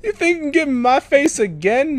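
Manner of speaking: high-pitched victorious taunt; gloating taunt. Real, not acted. Gamer taunting a beaten opponent, high gloating. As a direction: crow it high at the loser.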